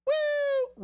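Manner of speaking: high loud celebratory whoop. Real, not acted. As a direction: whoop like a hype man. Whoop after punchline.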